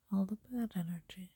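normal talking voice

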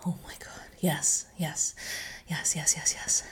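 ordinary talk

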